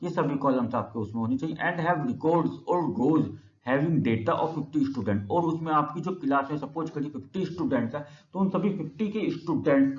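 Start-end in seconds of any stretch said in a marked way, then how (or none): 1.6-5.3 slow dictation. Shown as a demonstration. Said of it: "teacher dictating a definition".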